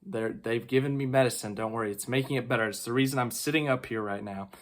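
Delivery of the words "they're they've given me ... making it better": ordinary delivery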